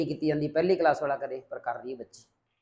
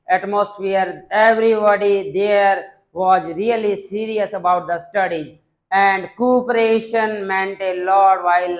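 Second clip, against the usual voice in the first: slow, louder, carefully paced English; model speech demo; 3 semitones higher, 5 dB louder, much slower than usual. Shown as a demonstration. Teacher models an English answer for students, slow formal delivery. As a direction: read it out as the model answer for the class.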